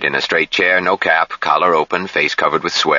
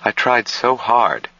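put-on high, slow, drained young woman; flat voice; 6 semitones higher, slower than usual. A narrator's reading. Jill confesses her failure to Harshaw, drained. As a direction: say it slowly, drained. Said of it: "she answered in a flat voice".

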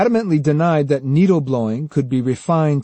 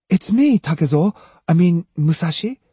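higher-pitched, tentative female character voice; halting, unsure, lifted voice; hesitant; 5 semitones higher, 4 dB louder than usual. A narrator's reading. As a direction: reintroduce yourself uncertainly, pitched up. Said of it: "she said hesitantly".

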